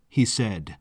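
ordinary delivery, nothing marked

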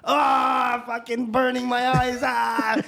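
yelling out with eyes burning; retold pained yell. Rookie yelling as concrete burns his eyes, loud. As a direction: shout it like concrete just hit your eye.

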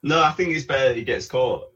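ordinary delivery

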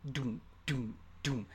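slow, spaced-out "doom" thuds; imitated drum beat. Arguing nobody can do both parts, mimicked slow drum beat. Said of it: "at the same time".

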